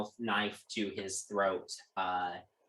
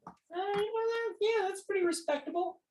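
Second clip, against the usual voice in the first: high-pitched impressed voice. Acted.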